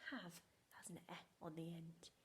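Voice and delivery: whispered, conspiratorial; secretive whisper. Shown as a demonstration. Teacher whispering how Tricky hid the E. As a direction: let the class in on a secret in a whisper.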